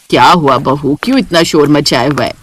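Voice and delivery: dismissing it as the maid's cooking; mother-in-law. Acted. (सास की धीमी, भारी आवाज़)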